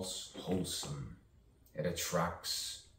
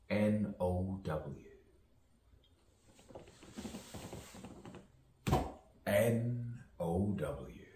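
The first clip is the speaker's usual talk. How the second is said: slow, each letter separate; spelled slowly; 3 semitones higher, slower than usual. Shown as a demonstration. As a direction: spell N O W out deliberately. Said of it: "spelled out letter by letter".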